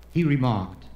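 ordinary delivery

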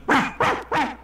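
high, loud sharp barks; dog barking. Acted. Mother cat scares off the bulldog, barking sharply. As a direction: bark like a dog to scare one off. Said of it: "a cat barking".

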